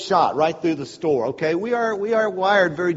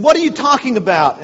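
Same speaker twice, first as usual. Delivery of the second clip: imitating her incredulous, louder question; mock-indignant wife pitched up; indignant retort; 7 semitones higher, 7 dB louder than usual. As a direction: her huffy disbelief, lightly acted. Debate with vegetarian woman retold.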